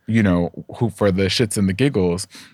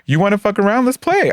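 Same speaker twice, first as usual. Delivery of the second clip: much higher, teasing challenge; playful dare; 12 semitones higher than usual. Retold or imitated. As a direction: squeal it like a game is starting. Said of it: "And me, I would be like".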